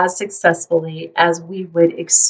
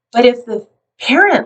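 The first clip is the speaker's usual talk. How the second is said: ordinary delivery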